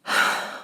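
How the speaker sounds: a soft, exasperated sigh through the nose; weary sigh. Acted. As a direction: sigh as if the listener tries your patience. Weary sigh at clueless listener.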